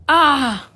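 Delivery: loud, high startled cry; a sharp cry of horror; sudden cry of shock. A narrator's reading. Ahab sees the dead Fedallah in the ropes, cry of shock. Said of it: "Captain Ahab suddenly cried".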